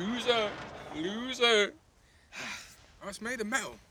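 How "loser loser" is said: taunting shout. Acted. Taunting friend from the roof.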